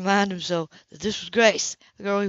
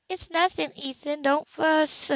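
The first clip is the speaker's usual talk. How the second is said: higher, dismissive; Grace brushing it off; 7 semitones higher, slower than usual. A narrator's reading. Grace to Ethan, higher and brushing it off. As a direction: wave it away lightly.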